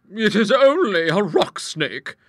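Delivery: high, loud, slow explanation; unruffled hostess. Acted. Hostess reassuring frightened visitors, high and deliberate.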